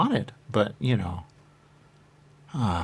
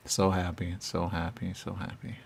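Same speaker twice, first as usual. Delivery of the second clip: fading to a whisper; 5 semitones lower, 8 dB quieter than usual. Acted. Repeating words, fading away.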